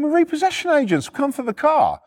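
high, insistent explanation. Retold or imitated. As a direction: insist over shouting, pitch well up.

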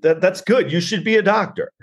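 high-pitched mocking comeback; mock exasperated retort. Comic answering the complaining son, high mock exasperation. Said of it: "he's just like".

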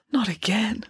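a small dismayed groan in the voice; moan. A narrator's reading. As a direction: moan it wearily. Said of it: "moaned Friday".

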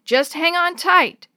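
high, loud, drawn-out call; shouted warning, stretched out; urgent shout. A narrator's reading. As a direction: call it out over the roar of rapids. Guide calling out in rapids.

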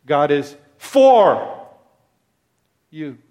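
for drawn out slowly and held; stress on for. Shown as a demonstration. Preacher stressing for to his congregation. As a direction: lean long on for.

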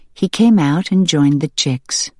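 ordinary delivery, nothing marked

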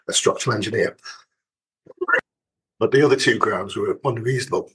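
losing voice, strained; voice giving out. Real, not acted. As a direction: voice failing mid-sentence. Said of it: "Sorry, I'm losing my voice".

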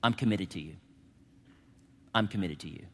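low, hushed words of acceptance; gentle reassurance. Acted. God reassures the forgiven sinner, gently. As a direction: drop soft and low, pausing after each promise.

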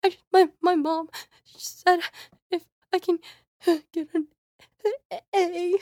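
imitated out-of-breath sobbing, high and broken by gasps; crying voice. Child begging teacher for an A, imitated breathless crying voice. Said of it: "I did the crying voice when you're, like, kind of out of breath".

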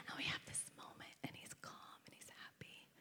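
barely-there whispered storytelling; hushed, intimate moment; tender whisper. Real, not acted.

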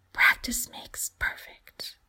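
put-on whispered, slow child recital; under his breath. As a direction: murmur it to yourself. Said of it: "recites under his breath".

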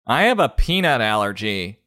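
low mock complaining voice; whiny parent. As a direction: play a fussy parent.

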